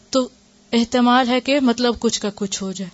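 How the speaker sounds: ordinary delivery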